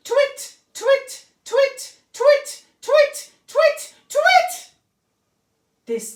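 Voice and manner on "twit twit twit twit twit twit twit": high, loud repeated twits; owl twittering. Acted. Olive twitters over and over for her dream owl.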